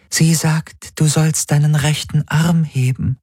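high, level alien interpreter voice; Helix voice. A narrator's reading. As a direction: relay the message in a high, even tone. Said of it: "sagte die Helix". (hohe, gleichförmige Helix-Stimme)

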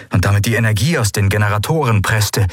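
usual speaking voice

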